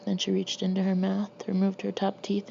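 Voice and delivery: usual speaking voice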